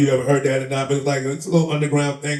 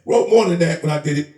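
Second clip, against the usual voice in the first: ordinary talk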